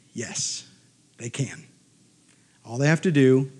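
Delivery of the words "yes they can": soft, low, sincere answer; quiet conviction. Real, not acted. Speaker answers whether one can change culture, quiet conviction. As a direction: drop right down and mean it. Hushed earnest answer to own question.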